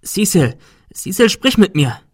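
calling out loud and urgent to a dying friend; desperate call. Acted. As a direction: shout his name to keep him awake.

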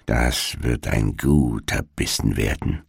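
low, soft witch mutter; murmured to herself. A narrator's reading. Witch eyeing the sleeping children, low soft murmur. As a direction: murmur it greedily under your breath. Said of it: "so murmelte sie vor sich hin".

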